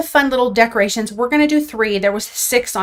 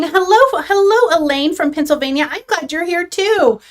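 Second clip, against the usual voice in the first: bright, much higher excited hellos; excited greeting; 6 semitones higher than usual. Real, not acted. Greeting a viewer who just joined the livestream, excited high hello. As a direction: call out a delighted hello to a newcomer.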